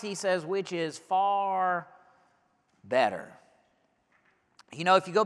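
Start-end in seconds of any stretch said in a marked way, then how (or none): 0.5-3.3 far stretched out slowly, better dropped soft; stress on far. Shown as a demonstration. Preacher lingering on far to stress it. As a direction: lean slowly into "far", let "better" fall away. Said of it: "there's actually three words there".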